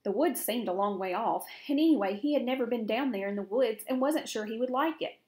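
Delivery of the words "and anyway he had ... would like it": ordinary delivery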